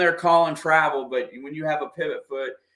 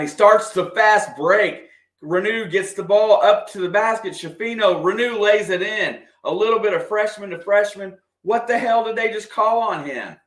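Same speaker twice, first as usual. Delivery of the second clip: higher and louder, racing the call; excited play-by-play; 6 semitones higher, 4 dB louder than usual. Real, not acted. Fan calling the fast break then questioning the refs. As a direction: build with the fast break into outrage.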